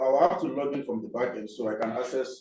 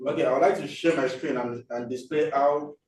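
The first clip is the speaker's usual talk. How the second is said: louder, raised voice; speaking louder; 4 semitones higher, 6 dB louder than usual. Real, not acted. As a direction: push the voice out for the room. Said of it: "[name], speak louder".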